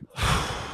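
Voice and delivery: soft deflated exhale; mock heavy sigh. Reacting to buddy's breakup news, quiet deflated sigh. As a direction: let the air out quietly.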